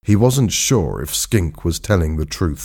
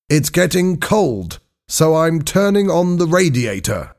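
high-pitched character voice; diver doctor reporting; 9 semitones higher than usual. A narrator's reading. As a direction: report each step from below, pitched high.

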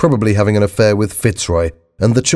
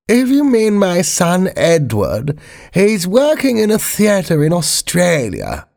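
slow, high-pitched Queen; high, deliberate royal voice; the Queen correcting; 10 semitones higher, slower than usual. Acted. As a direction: voice a Queen in a much higher register, unhurried.

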